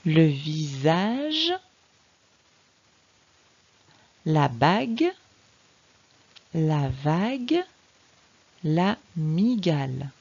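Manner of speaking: very slow word reading, syllables stretched; deliberate, drawn-out phonics reading; slow sounding-out. Shown as a demonstration. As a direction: read each word out separately for a beginner. Teacher models words for reading.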